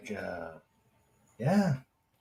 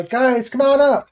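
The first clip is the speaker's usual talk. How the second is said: calling out; 5 semitones higher, 7 dB louder than usual. Real, not acted. Calling guests up.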